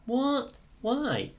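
high, disbelieving what and why; exasperated surprise. Real, not acted. Held at the hold again.

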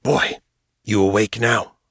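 a lightly voiced ragged old man, hissing. Prisoner calling through the wall, a mild hiss. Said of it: "a ragged male voice hissed".

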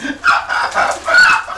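high unvoiced hyena cackle; hyena laugh. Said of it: "this is my attempt of a good hyena laugh".

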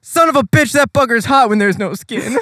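hammed-up mad scientist burned by the arm; manic Hanji. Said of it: "she's like".

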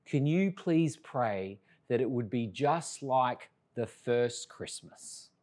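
put-on a soft, wistful child's plea; daughter's soft plea. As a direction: lightly voice a small child asking earnestly.

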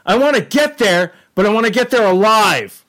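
high-pitched snail quote; excited snail character. Retold or imitated. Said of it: "the snail's like".

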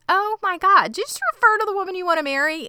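high, fast, loud incredulous rant; outraged disbelief. Real, not acted. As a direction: spit it out in shocked outrage, rushing. Outraged at the horse remark.

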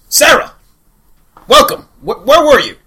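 loud, high booming greeting; booming mentor. Acted. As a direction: greet her like a grand old master.

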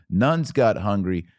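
usual speaking voice

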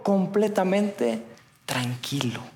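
slow, falling to soft; hushed calm. Real, not acted. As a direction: let the calm settle in the voice. Storm stilled, voice drops.

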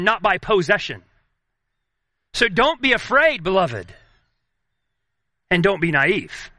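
ordinary talk, nothing marked

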